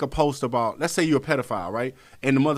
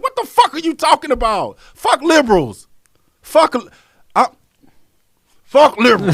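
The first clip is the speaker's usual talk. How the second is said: higher and louder, yelling; furious; 10 semitones higher, 8 dB louder than usual. Retold or imitated. Yelling back at commenters about left versus right, furious. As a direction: blast the commenters, fed up. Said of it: "I'm like".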